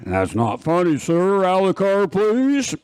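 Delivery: rising, mock stern order; hammed-up officer ordering him out; stern cop. Cop telling the joking driver to get out, in a mock stern rising voice. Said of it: "if I pull that stuff".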